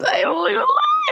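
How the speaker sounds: a high squeak of delight; giddy squeal. Real, not acted. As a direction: squeal it like a fangirl. Giddy over the fantasy.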